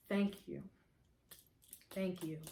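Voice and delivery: soft, low, sincere thank-you; heartfelt thanks. Real, not acted. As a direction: drop to a quiet, grateful hush with long pauses. Thanking her helper directly, moved.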